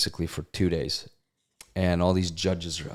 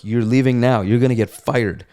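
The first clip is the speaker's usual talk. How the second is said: alarmed warning; 4 semitones higher, 7 dB louder than usual. Retold or imitated. Buddy warning him.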